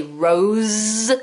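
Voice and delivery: ROSE held long on a voiced Z; buzzing Z. Shown as a demonstration. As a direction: draw out "rose" so the final Z buzzes. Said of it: "you can feel the Z sound".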